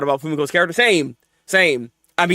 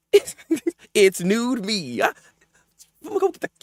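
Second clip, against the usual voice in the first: laughing; 4 semitones higher than usual. Real, not acted. Laughing through reading.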